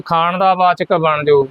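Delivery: ordinary talk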